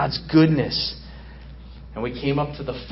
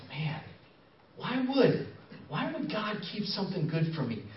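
softer, doubting murmur to herself; talking to herself; 2 semitones higher, 9 dB quieter than usual. Acted. Eve in the skit doubting God, softly to herself. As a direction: wonder it aloud under your breath. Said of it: "she's speaking to herself".

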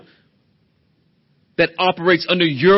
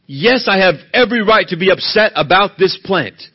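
loud, stubborn insistence on "every right"; defiant anger; 5 semitones higher, 6 dB louder than usual. Acted. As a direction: push back hard and loud. Jonah's angry reply voiced.

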